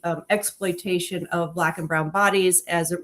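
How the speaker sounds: ordinary talk, nothing marked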